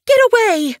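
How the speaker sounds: sharp, high, loud warning cry; fierce hiss. Acted. Snowfur driving off a kittypet, fierce and loud. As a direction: drive the intruder off with a snarl. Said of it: "Snowfur's hiss".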